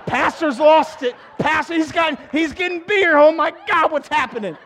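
mock outrage, high and loud; scandalised churchgoers. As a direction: play a congregation aghast at their pastor. Mimics religious people reacting in shock.

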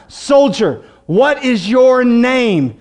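drawn-out, weighty commander's question; deliberate, forceful interrogation; slow stern demand. Acted. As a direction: stretch each word of the demand slowly. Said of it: "A third time".

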